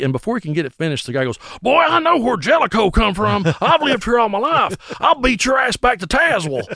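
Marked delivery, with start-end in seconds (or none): 1.6-6.7 put-on angry old drunk. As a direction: threaten a fight as a riled-up barfly. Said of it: "This old drunk man shooting pool".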